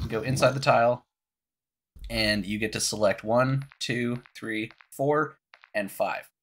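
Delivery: ordinary delivery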